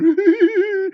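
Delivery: high, snide giggle; mocking giggle. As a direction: giggle at them like a smug drinker. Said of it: "giggling into their fucking old fashions".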